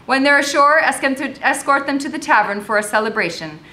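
officer projecting a command; loudly. A narrator's reading. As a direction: give orders for all to hear. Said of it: "the captain said loudly".

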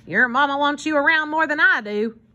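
lightly voiced grumbling hobo; hobo Armand. A narrator's reading. Armand answering the children, lightly voiced protest.

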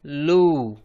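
लू as l plus long oo; blended syllable demo. Shown as a demonstration. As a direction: model लू for learners. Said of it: "तो बन गया".

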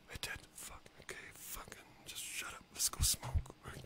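hushed whisper, telling himself to shut up. Real, not acted. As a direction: drop to a whisper to quiet himself. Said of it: "Did I just say that with my outside voice?".

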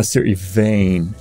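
ordinary talk

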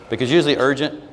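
normal talking voice

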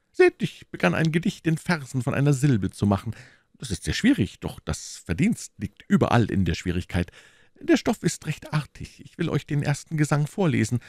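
high, animated musketeer poet; proud amateur poet. Acted. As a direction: present your poem with eager pride. Reader voices the musketeer poet.

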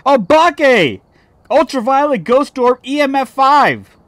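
high, loud indignant yelling; loud rant. Real, not acted. Gamer ranting about the ghost's true type, loud indignant yelling. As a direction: yell the evidence list at the game.

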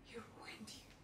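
barely audible whisper; very soft, all breath; hushed whisper. Acted. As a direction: whisper it to yourself. Monologue, repeated to herself, whispered.

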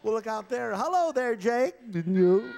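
ventriloquist's dummy hailing Jake; puppet voice. Acted. Ventriloquist voicing the dummy toward Jake, high and loud.

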